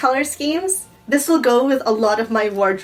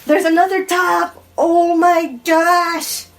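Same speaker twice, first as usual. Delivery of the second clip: high, loud excited squeal; real excited shriek over a gift; freaking out; 5 semitones higher, 4 dB louder than usual. Real, not acted. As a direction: stretch the oh my gosh out in delight. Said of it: "I'm just already freaking out".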